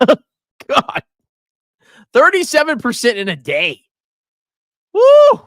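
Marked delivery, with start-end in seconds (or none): 4.9-5.4 very high, loud whoop; a sudden whoop at a wild price jump; excited whoop. Real, not acted. Host reacting to a meme stock's jump, whooping loudly.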